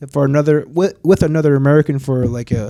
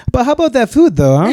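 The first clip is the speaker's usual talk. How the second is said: higher, louder jokey pivot; brightly change the subject as a joke; mock-cheery deflection; 9 semitones higher, 4 dB louder than usual. Joking away from grim war talk, mock-cheery.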